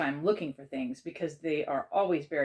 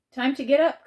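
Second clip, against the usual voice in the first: higher, louder wake-up call; 10 semitones higher, 9 dB louder than usual. A narrator's reading. Aunt to child at wake-up time, calling out. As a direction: call it out brightly to someone still in bed. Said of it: "called Aunt Misty".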